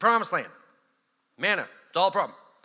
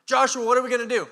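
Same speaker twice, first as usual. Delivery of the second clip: higher and louder pleading; anxious people asking; 7 semitones higher, 7 dB louder than usual. Retold or imitated. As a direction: press the leader for an answer. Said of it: "people ask Joshua".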